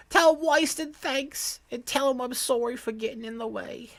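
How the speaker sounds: put-on high, apologetic little kid; child's voice. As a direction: voice a sorry small child.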